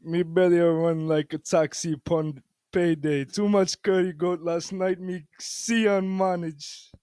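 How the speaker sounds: best attempt at a loud Jamaican accent; amateur Jamaican patois, projected for the room. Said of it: "talk in his best Jamaican accent".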